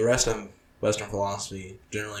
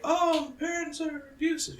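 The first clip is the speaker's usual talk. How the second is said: mocking. Mocking the bland movie.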